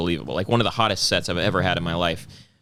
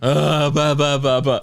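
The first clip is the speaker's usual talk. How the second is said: slightly louder stunned babbling; mock dumbfounded babble; 4 semitones higher, 4 dB louder than usual. Staring at the comet, mock stunned babbling. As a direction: babble like you can't speak. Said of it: "I was like".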